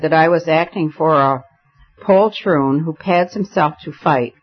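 ordinary delivery

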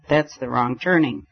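plain reading voice

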